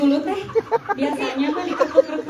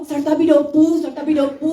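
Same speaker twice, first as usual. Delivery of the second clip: lightly voiced, louder repeated phrase; imitating his muttering; 5 dB louder than usual. Mocking how the co-star kept saying it, mildly voiced. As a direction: mimic the colleague's chant-like line. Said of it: "kerjanya ngomong".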